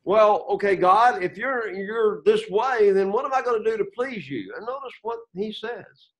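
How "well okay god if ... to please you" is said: louder, higher pleading of the people; the people answering. Acted. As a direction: act out the people's anxious reply to God. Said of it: "So now the people give their response".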